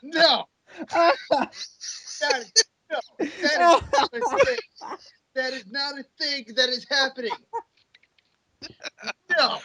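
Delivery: high, laughing wait wait; laughing protest. Real, not acted. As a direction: protest in mock horror. Protests the Eddie joke.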